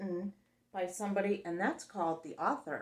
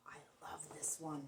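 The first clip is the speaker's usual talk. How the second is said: soft whisper; 4 semitones lower, 15 dB quieter than usual. Real, not acted.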